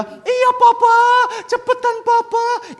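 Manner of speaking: put-on very high, loud little-boy wail; pleading child. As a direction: beg dad in a hammed-up toddler voice. Son hurries father.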